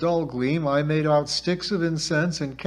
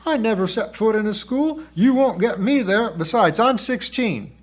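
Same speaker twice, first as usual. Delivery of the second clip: high, loud indignant protest; outraged refusal in a high voice; scandalized; 8 semitones higher, 6 dB louder than usual. A narrator's reading. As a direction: protest hotly, pitched way up.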